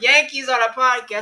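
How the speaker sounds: playful high announcer voice; hammed-up warning call; mock announcement. Repeats mock warning, teasing.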